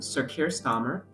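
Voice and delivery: ordinary delivery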